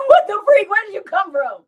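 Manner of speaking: high loud shocked laugh; startled, laughing at him. Retold or imitated. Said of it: "And I'm like".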